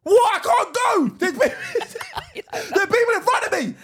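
high, loud indignant protest, breaking into laughter; indignant driver. Retold or imitated. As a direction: play the annoyed man who got out of his car. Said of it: "The guy in front gets out".

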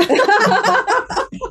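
loud high burst of laughter; big high-pitched laugh. Real, not acted. Joking about age requirement.

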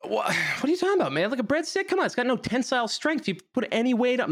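high, fast incredulous rant; mock outrage. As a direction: sputter in disbelief. Mock outrage over breadsticks.

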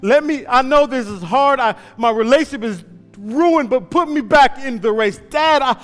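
loud, high, desperate pleading; son pleading. Acted. Injured runner begging his father to put him back, loud high and desperate. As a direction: beg your father to get you back in.

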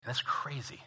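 whispered aside; And that's crazy, whispered. Real, not acted. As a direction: breathe it out unvoiced. Whispered aside after the reading.

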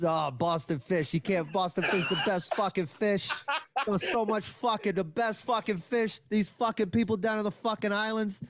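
put-on thick Boston accent rant. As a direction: rant like a proud Boston local. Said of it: "one of the best Boston accents I've ever heard".

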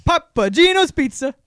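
high, loud vendor call; stadium vendor hawking. Pretend. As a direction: shout out pizza like a hawker. Mock stadium vendor calls.

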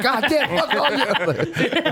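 high, drawn-out mock anguish; mock anguished yell. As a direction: wail it out, half laughing. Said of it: "and I'm like".

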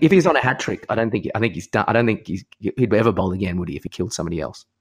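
ordinary talk, nothing marked

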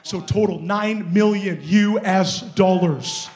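loud, triumphant testimony; forceful preaching. Real, not acted. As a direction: proclaim it to the whole church.

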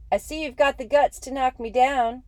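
loud challenging yell. Acted. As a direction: shout the dare across the field. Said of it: "Jackie yelled at Schoolboy Rowe".

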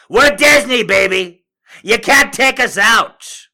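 mock Disney executive crowing at the internet; smug Disney. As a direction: gloat like an untouchable studio. Mocking Disney's voice.

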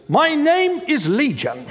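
slow, sniffy mock-Pharisee reading. Preacher mimicking the congregation as Pharisees, mock haughty. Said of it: "I think you sound like the Pharisees".

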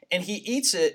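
ordinary talk, nothing marked

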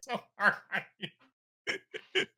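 high-pitched, drawn out through laughter; helpless laughter while teasing; laughing hard. Real, not acted. Friend teasing the one who got dunked on, laughing hard.

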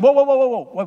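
loud, high "whoa, whoa" to halt a crowd; calling the crowd to stop. Acted. Caleb silencing the frightened crowd, loud and high. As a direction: shout them down like Caleb hushing the people.

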